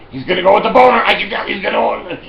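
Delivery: high, loud betting bystander; excited onlooker. Retold or imitated. Crowd member betting on the customer's choice, excited call. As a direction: call it out like a punter sure of the bet. Voices the crowd taking bets.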